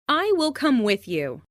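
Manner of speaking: ordinary talk, nothing marked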